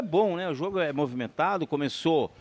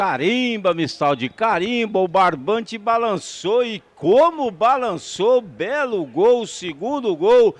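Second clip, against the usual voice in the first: goal celebration; 2 semitones higher, 7 dB louder, slower than usual. Real, not acted.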